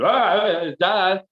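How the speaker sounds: loud, high re-enacted scream; waking-up scream. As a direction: cry for dad as if jolting out of a nightmare. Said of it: "I woke up going".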